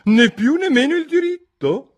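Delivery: very high, loud character voice; loud, squeaky-high Duchess retort; imperious Duchess. A narrator's reading. Duchess voiced in narrated story.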